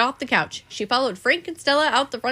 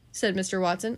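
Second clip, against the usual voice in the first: ordinary delivery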